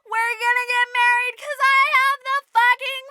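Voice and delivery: high, loud hammed-up gloating; triumphant stepsister. Stepsister rides off with prince, crowing loudly. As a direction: crow it, stretching the ending. Stepsister crows while galloping off.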